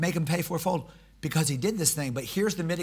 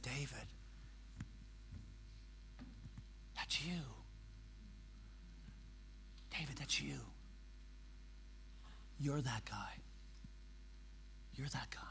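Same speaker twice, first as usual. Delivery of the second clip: much softer, gentle, close; quiet confrontation; 3 semitones lower, 21 dB quieter than usual. Retold or imitated. Nathan gently telling David the truth. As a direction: say it softly, right to his face. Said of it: "I don't think that's what he did".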